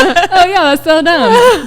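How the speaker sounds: high, loud, laughing through it; laughing at her own worry, loud and high; laughing at herself. Retold or imitated. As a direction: laugh it off as silly. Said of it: "And I'm like".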